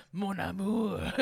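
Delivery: loud, slow French lover's croon; mock French accent. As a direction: stretch it out in romantic cartoon French. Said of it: "This is exactly how you say it".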